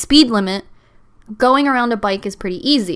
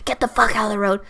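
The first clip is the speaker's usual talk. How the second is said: raspy smoker passenger impression; cigarette-smoker voice; faster than usual. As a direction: rasp it out like an irritated smoker. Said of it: "I can do that voice".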